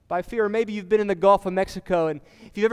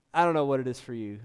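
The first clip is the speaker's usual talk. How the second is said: normal talking voice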